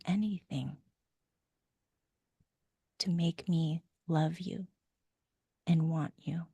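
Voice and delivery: put-on hushed loving words to a small child; tender comforting. Shown as a demonstration. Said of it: "and go".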